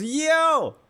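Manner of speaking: high-pitched mock holler; imitated scream. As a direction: mimic a kid calling out for attention. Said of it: "he's screaming at me".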